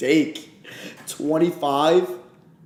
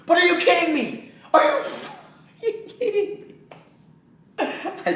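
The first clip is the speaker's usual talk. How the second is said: high, incredulous laugh; laughing disbelief. Real, not acted. Laughing outrage at trade.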